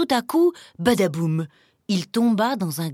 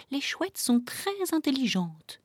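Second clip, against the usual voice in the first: higher, soft murmur; murmuring doe; 4 semitones higher, 6 dB quieter than usual. A narrator's reading. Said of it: "murmura la biche". (murmure doux de biche)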